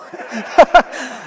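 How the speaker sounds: loud laugh bursting out; burst of laughter. Real, not acted. Laughing about driving past the stuck lady, loud. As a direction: laugh loudly at the memory. Laughing at their own refusal.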